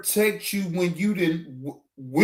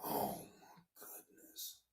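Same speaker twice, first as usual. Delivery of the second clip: low whispered disbelief; stunned whisper; 23 dB quieter than usual. Real, not acted. After the interview claims, a low stunned whisper. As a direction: let it out under the breath.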